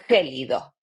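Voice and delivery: gélido with a throaty rasped G; Spanish gélido. Shown as a demonstration. Teacher contrasting the G of gélido with gato. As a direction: give gélido a scraped throat G. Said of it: "but it's like".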